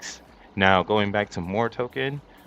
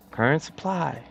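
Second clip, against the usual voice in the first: "Current supply" repeated much higher; high emphatic echo; 8 semitones higher than usual. Shown as a demonstration.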